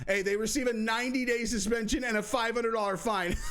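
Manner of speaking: reading through a laugh; laughing while reading. Real, not acted. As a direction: let the laugh spill into the words.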